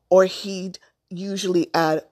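plain reading voice

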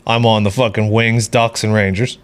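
usual speaking voice

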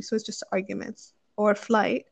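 normal talking voice

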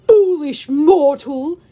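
loud, slow monster scorn; menacing Cyclops. Acted. Cyclops reveals his trick, booming slow menace. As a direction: boom it as a treacherous giant.

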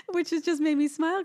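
talking through a laugh. Real, not acted. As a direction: giggle through the words. Laughing while speaking.

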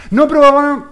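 put-on high, loud shouting kid; child shouting protest. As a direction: yell it like a boy at the end of his rope. Child protesting father's discipline.

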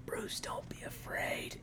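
slow whispered comfort; calm whisper. Retold or imitated. Friend repeats the dying father's line, whispered and slow. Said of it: "it's just like".

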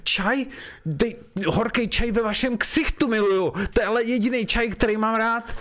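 high, hammed-up sneer; mock spite. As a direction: pretend fury at the boss. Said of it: "pojďme si to zahrát".

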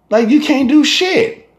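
high exasperated yelp; exasperated outburst. Real, not acted. Complaining that nobody can go out, exasperated high yelp. Exasperated rant about violence.

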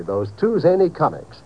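ordinary delivery

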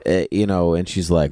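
normal talking voice

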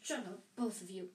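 mildly sharp command. A narrator's reading. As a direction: cut them off with a touch of sharpness. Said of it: "said Harry sharply".

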